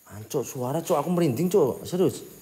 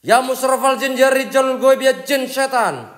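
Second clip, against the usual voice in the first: higher, chanted summons, slowing at the end; higher summoning chant, slowing at the end; incantation; 8 semitones higher, slower than usual. Real, not acted. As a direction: intone it like a ritual call. Invoking spirits at haunted hospital.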